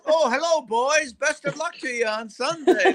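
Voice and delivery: super-polite Minnesota singsong, very high; high-pitched Minnesota niceness; mock Minnesota accent. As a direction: imitate chirpy, polite Minnesota fans. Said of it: "that accent".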